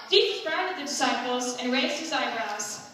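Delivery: normal talking voice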